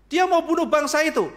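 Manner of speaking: nearly shouting the charge; insistent cry. Acted. Esther insisting loudly to the indifferent king.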